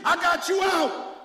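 high, loud, slow proclamation; I got you out, preached at a shout. Acted. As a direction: hammer it home to the church. Repeated preaching climax.

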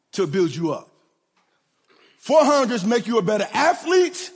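slow, drawn-out sermon build with long pauses; preaching cadence. Acted. Preacher to congregation on track lessons, slow preaching cadence. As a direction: let each phrase land slowly.

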